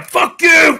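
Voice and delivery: mock yelling at the critic; retold shout. To the harsh critic, a high loud shout.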